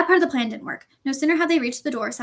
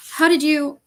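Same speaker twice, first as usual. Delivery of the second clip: louder, higher, shocked question; spluttering; 4 semitones higher, 8 dB louder, slower than usual. A narrator's reading. Professor shocked the boy knows the secret, spluttering. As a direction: splutter it and break off. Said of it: "she spluttered".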